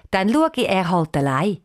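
ordinary delivery

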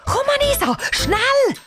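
very high, hurried summons; pressing, high-pitched girl's cry; urgent call. A narrator's reading. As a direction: call your friend over urgently. Urgently calling friend to van.

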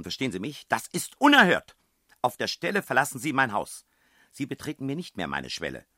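high, loud outraged shouting; landlady throwing the guests out; furious tirade. A narrator's reading. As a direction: threaten them with police, full rage.

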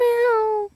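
high mock meow; a high little meow like a cat. Girl playing the Cheshire cat in Alice, a high meow.

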